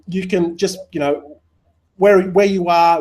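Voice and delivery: ordinary talk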